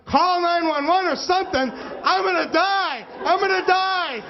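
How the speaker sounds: high, frantic panic, gonna die; panicked plea. Retold or imitated. At the wedding, begging guests to call nine one one, panicked. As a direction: beg for help in a high panic. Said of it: "And I'm like".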